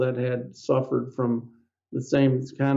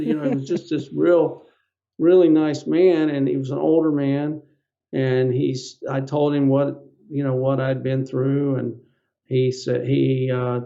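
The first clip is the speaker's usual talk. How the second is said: usual speaking voice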